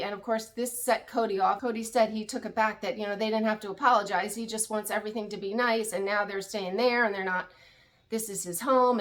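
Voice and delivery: ordinary delivery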